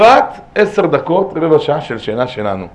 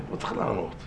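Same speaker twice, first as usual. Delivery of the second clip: hushed plea; 2 semitones lower, 11 dB quieter than usual. Real, not acted. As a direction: let the voice sink as you urge restraint. Closing soft plea not to answer. (בקשה שקטה בקול יורד)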